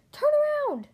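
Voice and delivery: very high, loud yell; calling out. A narrator's reading. Lightning to Mater on the dirt road, calling out. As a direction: holler it high after a runaway friend. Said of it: "Lightning called to him".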